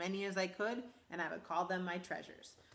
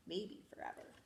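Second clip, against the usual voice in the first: soft breathy whisper; hushed dreamy whisper; whispered afterthought; 10 dB quieter than usual. Acted. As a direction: let it drift off as a secret.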